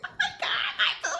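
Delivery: high, squealy mock gushing; hammed-up high-pitched delight; imitating her gushing. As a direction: squeal it like a thrilled girlfriend. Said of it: "she was like".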